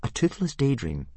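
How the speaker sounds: put-on high boy's voice; explaining. A narrator's reading. Boy to his glowering instructor, earnest explanation. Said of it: "explained Hiccup".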